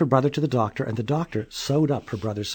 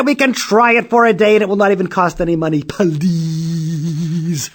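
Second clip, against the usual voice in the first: put-on eager pleading child; 5 semitones higher, 7 dB louder than usual. A narrator's reading.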